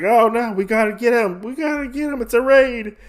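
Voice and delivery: high, loud alarmed cry of a raid; panicked guards. Retold or imitated. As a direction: squeal in alarm as the wall breaks.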